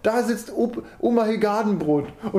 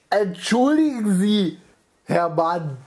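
nasal voice; slower than usual. Acted. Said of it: "in einer komischen, nasalen Stimme".

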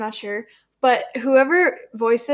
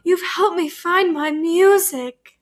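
high, slow, odd singsong voice; Caddy impression; 5 semitones higher, slower than usual. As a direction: imitate her strange slow delivery. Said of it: "a good impression".

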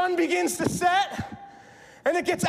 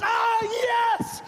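high, elated cry of joy; excited cheer; 6 semitones higher than usual. Acted. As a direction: burst out celebrating like a proud parent. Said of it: "There's just so much excitement".

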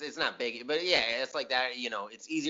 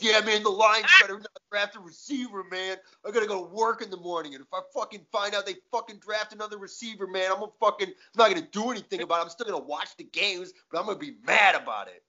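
higher, louder, fast ranting fan; sports radio caller; 7 semitones higher, 3 dB louder, faster than usual. Retold or imitated. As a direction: rant like a hometown caller. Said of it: "calls ninety-seven one The Ticket and is like".

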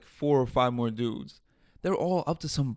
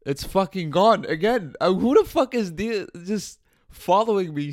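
pitched higher and louder in outrage; real angry disbelief, raised voice; indignant outburst; 6 semitones higher, 5 dB louder than usual. Real, not acted. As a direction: blow up in disbelief at the empty grave. Canary grave dug up again.

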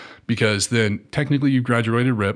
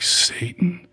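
scared whisper; 8 semitones higher than usual. Retold or imitated. Scared private's whispered reaction.

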